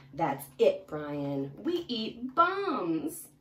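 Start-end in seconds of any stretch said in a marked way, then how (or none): 1.6-3.3 high, slow, weighty declaration; deliberately menacing; slow gruff piranha. Acted. Piranhas declare their food.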